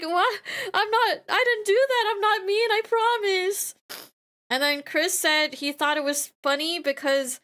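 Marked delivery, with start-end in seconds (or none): 0.0-3.7 high, pleading, whiny protest; mock whiny boyfriend. Girlfriend imitating her fiance's high, whiny protest. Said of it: "He was like".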